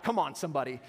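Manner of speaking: ordinary talk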